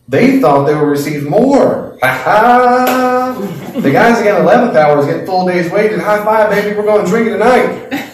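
loud, high, gloating cheer of lucky workers. Acted. Late workers crowing to each other, loud gleeful cheer. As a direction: crow it like you just hit the jackpot.

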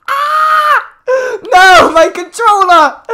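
high, loud panicked shriek; panicked scream. Real, not acted. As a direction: scream as you get caught. Caught by murderer, screams.

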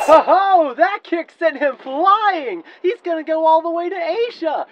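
high, loud whoop of triumph; gloating excitement. Acted. Sonic mid-fight admiring his kick, gloating. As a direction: crow over a great kick.

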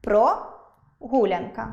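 прогулянка broken after ПРО, rising then pause; прогулянка split as про, long pause, гулянка; split for line break. Shown as a demonstration. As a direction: say про — гулянка, pausing after the first syllable. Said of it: "слово прогулянка".

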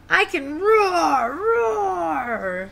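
high, slow, drawn-out roaring; triumphant little lion's roar. Acted. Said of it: "said Little Lion triumphantly".

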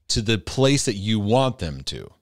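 normal talking voice

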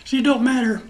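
ordinary delivery

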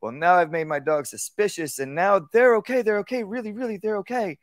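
high nervous they're okay, really; anxious over-reassuring. Shown as a demonstration. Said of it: "Usually what it is".

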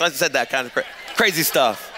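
normal talking voice

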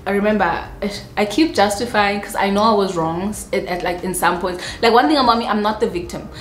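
normal talking voice

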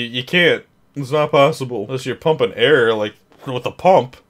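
ordinary talk, nothing marked

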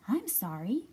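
a hint of twittery rabbit; twittering Rabbit. A narrator's reading. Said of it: "twittered Rabbit".

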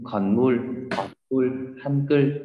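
Hangul with the final L made tongue curled up; cong lưỡi âm L. Shown as a demonstration. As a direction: curl the tongue up on the end of "Hòn Ngul". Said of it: "đấy, cong lưỡi lên". (đọc "Hangul" cong lưỡi lên thành âm L ở cuối)

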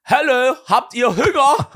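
hoch, laut und langsam, lachend gerufen, ostdeutscher Dialekt; Enten rufen. Retold or imitated. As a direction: wie zu Enten rufen, übertrieben. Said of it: "ruft er die Enten so". (Mann ruft den Enten lachend im Dialekt zu)

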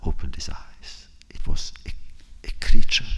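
hushed storyteller whisper; a secret whispered to the room. A narrator's reading. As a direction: lean in and whisper the build-up. Hushed start of eerie tale.

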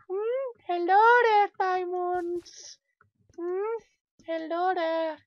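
very high, loud squeaky falsetto; silly calling voice. Pretend. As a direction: coo loudly to hidden treasure in falsetto. Calls to diamonds in silly voice.

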